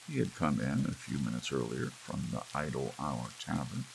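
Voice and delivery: ordinary delivery, nothing marked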